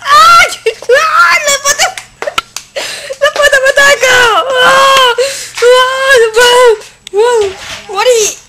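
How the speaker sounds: high, loud screams of panic; panicked screaming. Real, not acted. Player attacked by a creature screams in high loud panic. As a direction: shriek as the monster attacks.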